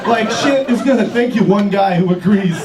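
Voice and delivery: normal talking voice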